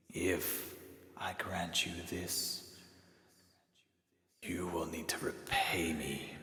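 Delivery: put-on hushed and weary, like something old from the earth; slow whispered otherworldly voice; ancient spirit voice. Toll, a spirit, speaks.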